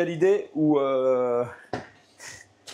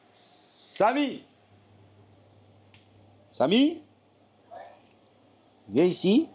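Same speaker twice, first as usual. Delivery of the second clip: calling out; 5 semitones higher, much slower than usual. Real, not acted. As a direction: call the name across the room. Calling out to people.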